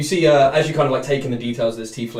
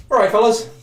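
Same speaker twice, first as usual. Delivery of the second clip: tiefling greeting; 10 semitones higher, 6 dB louder than usual. Acted. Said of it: "kind of sits down and goes".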